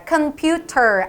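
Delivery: slow "computer" with crisp T and sounded final R; wrong British computer. Shown as a demonstration. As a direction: say "computer" keeping the R, not British. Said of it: "R 발음도 날려야 되기 때문에". ("computer" 끝 알 발음 남겨서)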